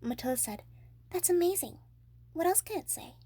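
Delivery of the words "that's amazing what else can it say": put-on high, louder delighted child; a girl thrilled by the parrot; high excited girl; amazed girl. A narrator's reading. Matilda voiced.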